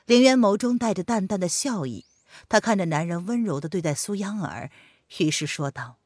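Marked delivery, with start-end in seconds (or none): none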